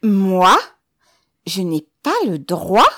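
loud, incredulous howl with rising ends; outraged shouted question; drawn-out yell. A narrator's reading. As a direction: yell it, dragging the words. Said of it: "hurla le commissaire en traînant sur les mots".

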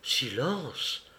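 hushed, alarmed warning; frightened. A narrator's reading. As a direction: shush someone in alarm, softly. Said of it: "fit Raymond effrayé".

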